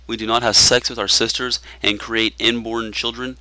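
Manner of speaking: ordinary talk, nothing marked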